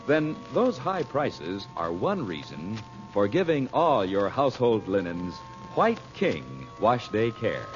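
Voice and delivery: normal talking voice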